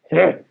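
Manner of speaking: loud grumpy grunt; harsh humph. A narrator's reading. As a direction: huff it out harshly. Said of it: "said the ogre harshly".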